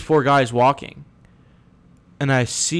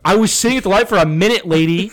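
angry retort; 7 semitones higher, 5 dB louder than usual. Retold or imitated. Retelling a road-rage argument.

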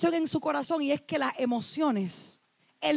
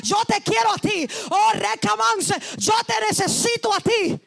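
impassioned preaching cry; 4 semitones higher than usual. Real, not acted. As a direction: cry out as if praying aloud. Preacher crying out in climax.

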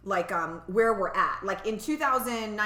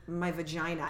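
deadpan; 6 semitones lower, 7 dB quieter than usual. Retold or imitated. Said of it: "like, deadpan".

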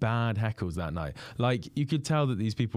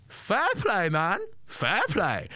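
much higher put-on impression on request; high mimic done again when asked; comedian impression; 10 semitones higher, much slower than usual. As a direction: repeat the borrowed voice for him. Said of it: "Do that one more time".

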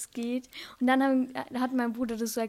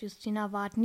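ordinary talk

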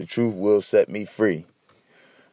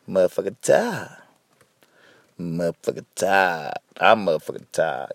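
imitating [name]'s daughter; 3 semitones lower, 3 dB quieter than usual. Quoting [name]'s daughter's viral clip, lightly imitated. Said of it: "that shit with, uh, [name]'s daughter?".

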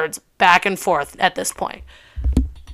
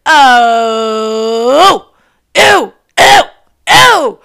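high, loud, drawn-out mock revulsion; disgusted ew; 4 semitones higher, 7 dB louder, much slower than usual. Reacting to the follower she liked, high shrill disgust. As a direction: squeal ew like something stinks.

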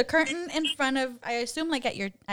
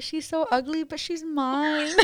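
higher, slowing on mine, half laughing; amused fond remark; 5 semitones higher, slower than usual. Retold or imitated. Mother seeing her newborn daughter, higher, slow fond amusement.

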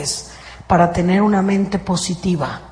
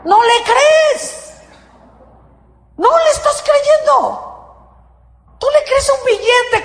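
rebuking cry. Real, not acted. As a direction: call out their lack of faith. Preacher rebukes congregation.

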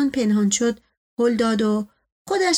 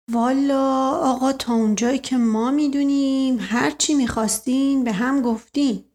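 cautious servant; 2 semitones higher than usual. Acted. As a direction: answer the master carefully, stretching the opening. Servant figure answers cautiously. (نوکری محتاط با لحن کشیده)

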